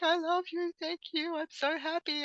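high-pitched imitation of a tearful daughter; mock happy tears. Mother retelling her daughter's birthday reaction, imitated happy tears. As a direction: voice an overjoyed, tearful child. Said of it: "it was happy tears".

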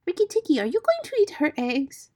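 high, loud singsong bird voice; high-pitched feather-brained bird; exultant songbird. A narrator's reading. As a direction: gush the news like a giddy bird. Voices Darzee the silly bird.

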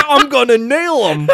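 high, loud, laughing battle cry; mock fired-up yell. Co-host imitating Daniel about to break boards, mock fired-up yell. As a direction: shout it like a hammed-up movie hero.

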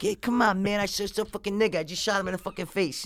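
high, soft movie-character patter; movie quote. Acted. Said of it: "That's what he says".

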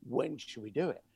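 sudden drop to low and soft; dropped tone. Shown as a demonstration. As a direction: drop the voice right down after the excitement. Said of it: "Drop your tone".

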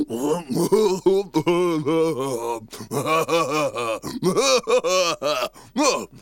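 long rolling laugh; deep king's laugh. Acted. King laughs for the first time in ages. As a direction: laugh like a waking old king. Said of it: "He said".

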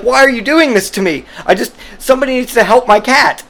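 high, whiny hammed-up protest; imitating a cuffed, indignant owner; mock wounded pleading. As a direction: plead like a baffled lunatic. Coworker hauled off, pleading.

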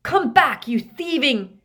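loud, high angry shout; shouting at the top of the voice; furious yell. A narrator's reading. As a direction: yell after a fleeing thief. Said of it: "at the top of his voice".

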